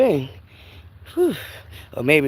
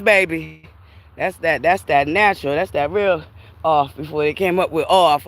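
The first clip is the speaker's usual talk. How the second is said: ordinary talk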